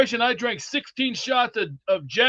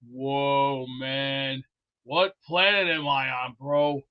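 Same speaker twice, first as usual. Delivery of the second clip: imitating a stunned guy; 8 semitones lower, 3 dB quieter, much slower than usual. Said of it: "You'll be going".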